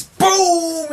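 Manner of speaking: high, booming mock explosion word; exclaimed impact. Fan retells Nami's kick to the face, loud exclamation. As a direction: shout the hit like a sound effect.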